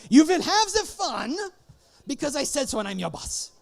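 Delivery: put-on loud, high, commanding German accent. Boss ordering staff to enjoy company-mandated fun, German accent. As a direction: bark it out as a bossy German. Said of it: "I'm gonna do this in a German accent".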